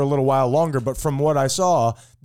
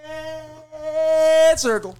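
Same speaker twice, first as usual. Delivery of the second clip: slow, high, stretched out; drawn-out announcement; 12 semitones higher, much slower than usual. Acted. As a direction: draw each word out grandly. Slow dramatic repeat of words.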